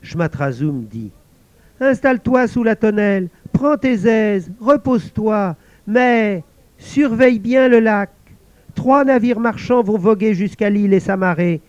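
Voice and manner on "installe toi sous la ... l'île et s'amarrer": high, pleased voice; a lonely spirit delighted at last; invisible servant exclaiming. Acted. Servant thanking the guard, high excited voice.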